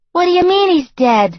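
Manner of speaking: high, loud disbelief; shocked girlfriend. A narrator's reading. Girlfriend hearing Peter is dead, shocked. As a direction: caught off guard by the news.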